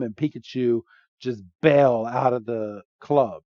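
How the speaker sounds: usual speaking voice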